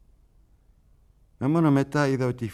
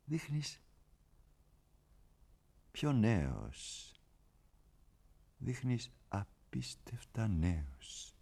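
hushed awe; 9 dB quieter, much slower than usual. Acted. Sudden hush after outburst.

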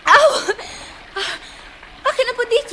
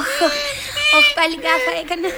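dismayed groan; 7 semitones higher than usual. Acted. Alice realises her blunder.

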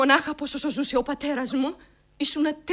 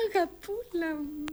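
put-on slow, soft and higher, doting on the little girl; tender; 5 semitones higher, 7 dB quieter, slower than usual. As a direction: coo the word αγαπούλα softly and slowly. (τρυφερά, αργά και σιγανά)

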